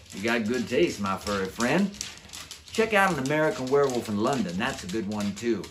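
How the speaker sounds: enthusiastic werewolf. Acted. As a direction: cheer him on like a pal. Darkheart praising Tom's taste.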